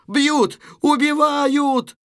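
high, loud, drawn-out scream; screaming for help. Acted. Natasha being beaten indoors, high scream for help. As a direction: cry murder, stretching the last word high.

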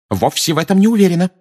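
quick, high, loud objection; exclaiming. A narrator's reading. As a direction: exclaim it fast, pitch leaping. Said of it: "воскликнула миссис Уизли". (воскликнув, быстро и высоко)

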